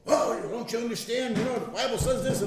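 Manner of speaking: high, mock-earnest pleading; hammed-up plea to the dead; imitating former pushy preacher self. To the unbeliever, a mock pleading preacher.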